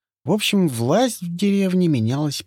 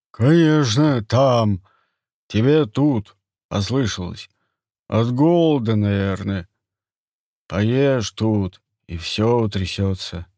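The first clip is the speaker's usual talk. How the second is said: sly hunter voice; 2 semitones lower, 4 dB louder, slower than usual. Acted. Turgam covers up his lie.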